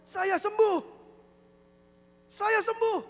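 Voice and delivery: high, loud exclamation, imitated; proclaiming healing. Healed man announcing to the church, high and loud. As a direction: shout it out like someone just healed.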